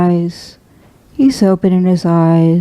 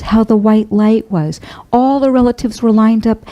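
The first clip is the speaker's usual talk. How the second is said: ordinary delivery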